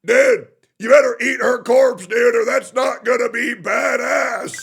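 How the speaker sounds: loud, high mock threat as a cartoon villain; mock villain shout. Streamer voicing Tectone as a villain, hammed-up shout. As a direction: yell it like a silly movie bad guy. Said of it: "and he said".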